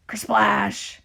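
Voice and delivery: explosive crashing sound word; loud kersplash. A narrator's reading. Reader voicing a second arm crashing, loud kersplash. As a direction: burst it out like a big wave.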